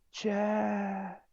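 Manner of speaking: high call from far away; distant call. Retold or imitated. Friend calling from afar, distant call. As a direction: call his name across the field. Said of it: "he was yelling".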